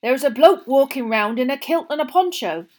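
the owner grumbles to Mr Weasley about odd campers; grumbling campsite owner. A narrator's reading. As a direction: grumble about the weirdos on your field.

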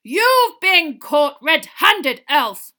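very slow, very loud roar; roared accusation. A narrator's reading. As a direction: roar it word by word at the culprit. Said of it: "Mister Diggory roared".

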